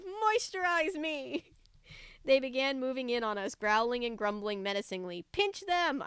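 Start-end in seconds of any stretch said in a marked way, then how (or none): 0.0-1.4 much higher, slow campy demand; quoted movie line. Retold or imitated. Reader quoting a famous movie line, campy slow demand. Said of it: "It just reminds me of". 5.3-6.0 a much higher urgent call; shouting. A narrator's reading. Kid shouting orders to his family, urgent high call. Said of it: "I shouted to my family".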